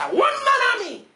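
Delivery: high, loud exclamation; ringing pulpit shout; preacher's shout. Acted. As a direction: cry it out as the climax. Climax of preacher's list.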